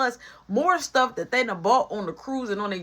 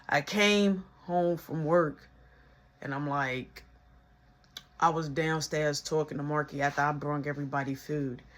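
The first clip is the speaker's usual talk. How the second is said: ordinary talk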